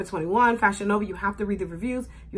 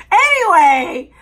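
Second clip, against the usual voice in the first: loud anyway; 7 semitones higher, 11 dB louder, slower than usual. Real, not acted. Recovering from laughter.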